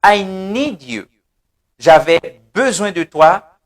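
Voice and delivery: normal talking voice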